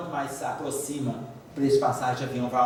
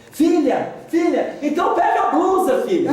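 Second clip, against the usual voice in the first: higher, louder fussing mom; mother's voice; 11 semitones higher, 7 dB louder than usual. Retold or imitated. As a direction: nag like a worried mother. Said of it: "Porque eu passo de mãe".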